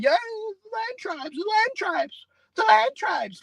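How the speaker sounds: high, drawn-out sarcastic cheer; mock cheering. Preacher refuses to sit and praise land tribes, mocking cheer. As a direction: cheer them on in a silly high voice, dragging it out. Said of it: "I'm supposed to sit here and".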